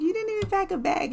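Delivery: high, fast incredulous jab; playful high-pitched disbelief; incredulous tease. Retold or imitated. Teasing boyfriend about no bag.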